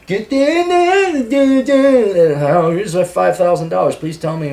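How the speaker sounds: high, drawn-out mock guru pitch; Tony Robbins, Tai Lopez. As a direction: hype it like a self-help salesman. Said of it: "Your Tony Robbins, your Tai Lopez".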